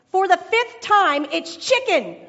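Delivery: loud, fed-up snap; exasperated wife. Retold or imitated. Wife snapping at her husband, loud and exasperated. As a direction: fire it back, sick of repeating.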